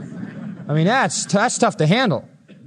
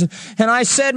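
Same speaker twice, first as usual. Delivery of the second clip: usual speaking voice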